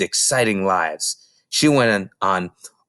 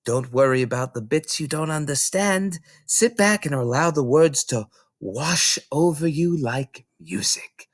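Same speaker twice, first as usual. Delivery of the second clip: higher, hesitant kindly librarian; concerned librarian thinking aloud; librarian Mrs Phelps; 4 semitones higher than usual. Acted. Mrs Phelps worrying about Matilda, hesitant librarian voice.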